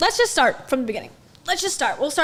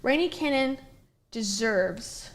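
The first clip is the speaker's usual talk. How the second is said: soft, halting words as you write; quiet self-dictation with long pauses; writing aloud; 9 dB quieter than usual. Real, not acted. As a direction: murmur it piece by piece while jotting it down. Saying words while writing them down.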